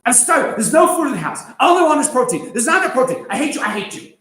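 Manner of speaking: loud, high mock teen tantrum; dramatic teenager. Teacher imitating a teenager complaining about food, loud tantrum. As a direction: overplay a sulky teen demanding protein. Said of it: "that's what teenagers say".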